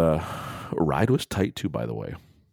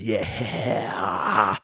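drawn-out, higher-pitched mock scream; imitated stage scream; 4 semitones higher than usual. Fan copying the bassist during Bells, imitated scream. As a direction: imitate a rock bassist's yell without full volume. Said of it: "you hear him fucking screaming, going".

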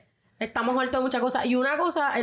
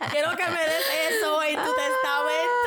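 giggling through a pretend request to mom; laughing, rising higher as it goes; laughing mock plea; 3 semitones higher than usual. Pleading to mom for her recipe books, laughing.

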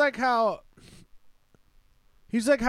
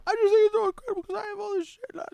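much higher, whiny mock sob voice; mock crying Bert. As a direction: ham up a weepy overwhelmed comic. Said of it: "And he's, like, crying".

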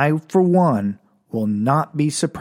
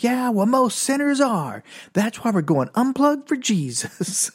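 preachy band hype; 8 semitones higher than usual. Retold or imitated. Band pitching Jesus to the crowd, preachy mock hype. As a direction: lightly imitate an over-keen band frontman.

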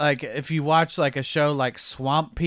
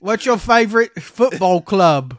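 mock British football fan; 5 semitones higher, 8 dB louder than usual. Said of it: "mocking UK football fans".

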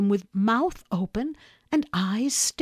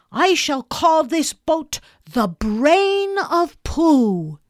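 proud proclamation; 8 semitones higher, 7 dB louder, slower than usual. A narrator's reading. Naming the boat proudly.

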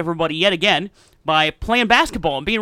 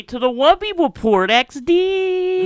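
announcer voice; 11 semitones higher, 4 dB louder, much slower than usual. Acted. Announcing the show title.

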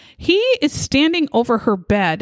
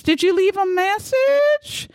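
mock innocent husband; 9 semitones higher than usual. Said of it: "he said".

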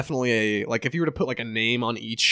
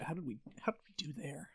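whisper; 3 semitones higher, 20 dB quieter, faster than usual. Real, not acted. Joking aside about accents.

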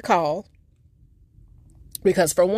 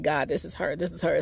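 hushed disbelief; 8 dB quieter, faster than usual. Retold or imitated. Realizing it's really auntie, a soft awed whisper. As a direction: murmur it in joyful disbelief. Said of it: "I immediately started smiling".

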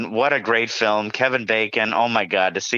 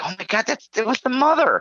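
much higher, stunned discovery; surprised realization; 11 semitones higher than usual. Retold or imitated. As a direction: gasp it out up high in shock. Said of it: "And I'm like".

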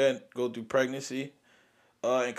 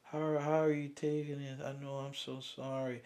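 softer, hushed condolence; sympathetic nurse; 9 dB quieter than usual. Retold or imitated. Nurse consoling grieving parents, soft and hushed. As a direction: say it gently, like breaking bad news. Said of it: "Guess what they telling us".

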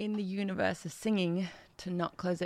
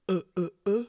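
sobby Count Dracula; 3 dB louder, faster than usual. Shown as a demonstration. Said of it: "particularly sobby".

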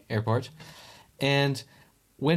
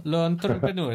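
hammed-up French accent, pitched up; 6 semitones higher than usual. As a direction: toss off a mock French "Le entrepreneur". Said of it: "Your French accent is a delight".

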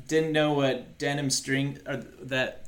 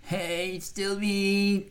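put-on voice; 5 semitones higher than usual. Future self, put-on voice.

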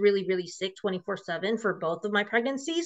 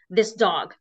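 ordinary talk, nothing marked

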